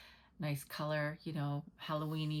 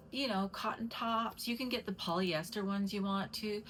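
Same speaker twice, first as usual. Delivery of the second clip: normal talking voice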